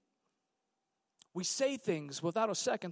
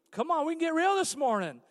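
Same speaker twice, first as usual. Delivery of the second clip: ordinary talk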